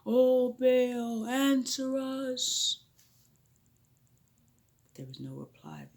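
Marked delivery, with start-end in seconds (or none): 0.1-2.8 loud, drawn-out cry to Baal; a desperate crowd calling O Baal; shouting prayer. Acted. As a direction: cry out to the idol, pleading.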